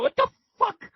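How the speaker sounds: a sudden very high yelp of disbelief; hearing the riot squad is coming, yelped very high; alarmed outburst. Retold or imitated. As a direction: shriek it, caught off guard. Hearing about tear gas.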